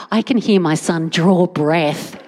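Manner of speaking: usual speaking voice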